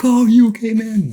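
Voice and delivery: high, loud mock dude voice; concerned rafting guys. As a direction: imitate the rafters checking on you. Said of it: "they're like".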